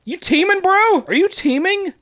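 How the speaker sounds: high, mocking accusation; accusing another player in a high mock voice; squeaky taunt. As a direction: needle them in a squeaky voice.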